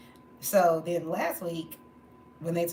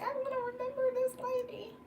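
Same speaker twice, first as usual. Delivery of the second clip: touched and grateful; faster than usual. Retold or imitated. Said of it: "because I was like".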